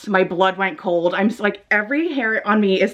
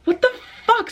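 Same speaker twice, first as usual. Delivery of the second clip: shocked; 13 semitones higher than usual. Retold or imitated. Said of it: "So I was like".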